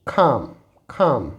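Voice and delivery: calm said as kam, no l; silent l demo. Shown as a demonstration. Teacher showing students the silent l in calm. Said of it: "it is".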